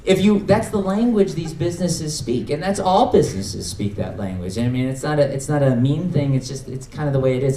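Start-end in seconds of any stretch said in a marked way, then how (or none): none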